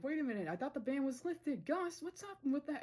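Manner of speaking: high, rising alarmed protest; shocked protest. Retold or imitated. Luce being taken by guards, high alarmed protest at Gus. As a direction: squeal it out as you're dragged away. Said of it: "Luce is like".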